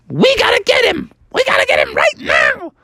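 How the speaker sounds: high squeaky urgent cry; high frog voice, urgent; urgent Froggy. Acted. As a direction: push to chase him now.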